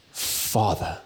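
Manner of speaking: Father breathed out in a soft whisper. Shown as a demonstration. Preacher showing Jesus' closeness, whispered tenderly. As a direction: let Father fall to a hush. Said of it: "That is the closeness of God".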